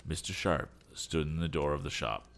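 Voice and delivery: ordinary delivery, nothing marked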